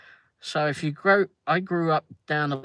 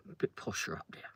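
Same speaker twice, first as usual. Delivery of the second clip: whisper; 7 semitones lower, 11 dB quieter, faster than usual. Real, not acted. Aside after posh impression.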